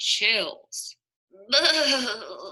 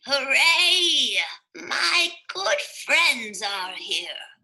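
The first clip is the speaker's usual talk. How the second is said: glad cheer; 5 semitones higher, 4 dB louder, slower than usual. Acted. Amos greets friends.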